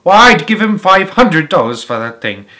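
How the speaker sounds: loud outbid. A narrator's reading. Woman outbidding loudly.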